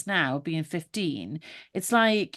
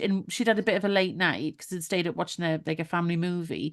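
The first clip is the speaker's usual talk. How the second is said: normal talking voice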